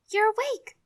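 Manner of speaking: high, loud burst of joy; overjoyed. Acted. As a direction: cry out in happy relief. Sister overjoyed he woke.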